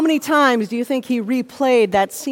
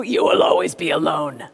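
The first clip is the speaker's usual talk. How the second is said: low, ominous verdict; condemning inner voice; 7 semitones lower than usual. Acted. Inner critic to someone seeing engagement photos, low condemning voice. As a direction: drop deep for a doom-laden pronouncement. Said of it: "and the voice says to you".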